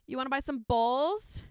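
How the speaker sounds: mock celebrity chef sales pitch; Rachael Ray impression. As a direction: hawk it like a TV chef selling merch. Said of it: "that's my Rachael Ray impression".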